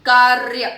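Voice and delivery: कार्य as loud "kaarrr-ya"; long trill in कार्य, no vowel after the r; correct rolled r. Shown as a demonstration. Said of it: "हमें क्या लिखना है".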